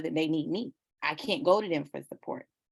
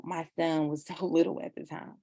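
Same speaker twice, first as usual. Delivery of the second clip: usual speaking voice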